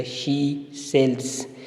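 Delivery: she sells said slowly with the s sounds drawn out; slow, stressed she sells. Shown as a demonstration. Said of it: "तो ये होगा alliteration".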